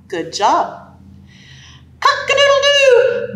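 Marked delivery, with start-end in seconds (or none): 2.0-3.3 high rooster crow. Acted. Rooster crow in picture book.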